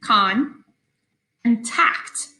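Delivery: "con" and "tact" as separate beats; syllables split. Shown as a demonstration. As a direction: break the word into two parts. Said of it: "two syllables".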